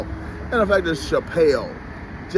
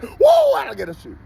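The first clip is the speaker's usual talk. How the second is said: a wild, high shouted Woo, then the line; Ric Flair woo; 5 dB louder, faster than usual. Retold or imitated. A podcaster shouts a Ric Flair woo in glee. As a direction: let out a Ric Flair war cry, way up high. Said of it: "Yeah, Ric Flair".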